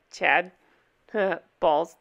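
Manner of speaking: lightly imitated sketch character, chuckle then one low word; Chad. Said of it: "all his character would say".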